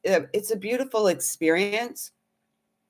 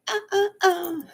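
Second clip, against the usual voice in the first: sung uh uh uh; 14 semitones higher than usual. Pretend. Song ending vocalise.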